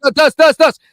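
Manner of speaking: loud, repeated call to cut in. Real, not acted. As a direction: shout his name over him. Shouting a name to interrupt.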